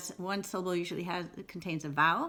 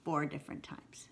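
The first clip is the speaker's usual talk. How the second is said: whispered; 4 semitones lower, 8 dB quieter than usual. Shown as a demonstration. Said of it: "answers in a whisper".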